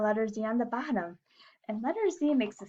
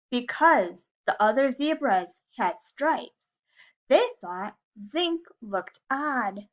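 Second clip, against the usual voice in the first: lively, louder storybook reading; reading to children; 2 semitones higher, 6 dB louder, slower than usual. A narrator's reading. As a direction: read it like story time for little kids. Reading a picture book to class.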